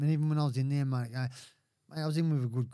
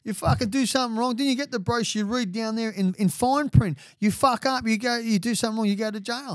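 louder, higher sarcastic telling-off; hammed-up scolding of a clueless newcomer; mock lecture; 9 semitones higher, 7 dB louder than usual. Lecturing whining new inmates about the criminal brochure, loud and mocking.

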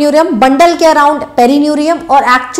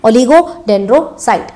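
slow drilled answer; 3 semitones lower, much slower than usual. Shown as a demonstration. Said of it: "कौन बनाता है".

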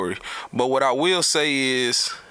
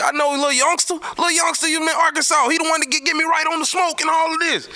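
much higher mock voice for Rambo, excited and bragging; imitating Rambo. Telling the friend about meeting Rambo, a much higher mock Rambo voice. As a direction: pitch way up to play Rambo hyping him. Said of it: "Rambo like".

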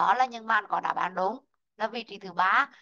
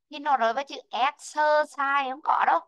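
usual speaking voice

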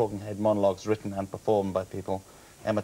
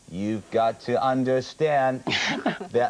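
slow cowboy-star drawl; Bush as John Wayne; much slower than usual. Acted. Comic showing how they changed Bush's voice, John Wayne drawl. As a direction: stretch every word like a western hero. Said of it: "They made him sound like John Wayne".

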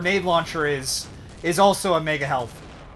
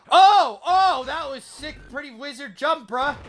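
high, drawn-out hype; excited; 8 semitones higher, 3 dB louder, slower than usual. Real, not acted. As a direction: hype up a sick jump. Hype after a big jump.

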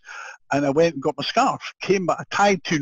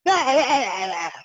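high-pitched fan shriek; imitated squeal like a girl; girlish scream; 10 semitones higher than usual. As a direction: let out a mock starstruck shriek. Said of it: "screaming like a girl".

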